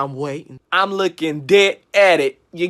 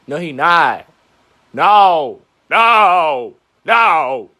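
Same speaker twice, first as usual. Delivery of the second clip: loud denial; 5 semitones lower, 7 dB louder, much slower than usual. Acted.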